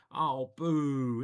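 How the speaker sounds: a loud playful boo at the opponent's choice; jokingly boo the move; disappointed streamer's boo; mock boo. Real, not acted. Opponent castles the boring way.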